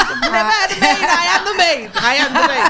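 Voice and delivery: high, loud, comic protest; mock indignant protest. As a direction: protest it loudly, half-laughing. Acted indignant retort, laughing.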